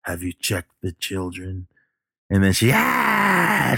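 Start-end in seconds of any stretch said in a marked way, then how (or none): 0.1-1.7 slow, hushed menacing caller; Duncan's voice. Retold or imitated. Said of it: "you hear Duncan's voice". 2.7-3.8 high, drawn-out mock scream. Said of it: "when she started screaming".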